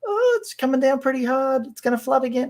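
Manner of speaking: high, fretful townsfolk voice; mock worry. Locals watching rain fall, high mock worry.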